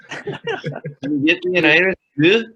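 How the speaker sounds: put-on player's laughing go at a Scottish accent, high and loud. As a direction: squeak out a pub order in mock Scottish. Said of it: "give us your best Scottish accent".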